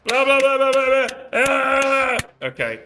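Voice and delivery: ordinary talk, nothing marked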